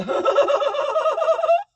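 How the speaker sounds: imitating a ridiculous high laugh; imitated ridiculous laugh. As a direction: cackle like the singer. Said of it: "follows up something ridiculous with a".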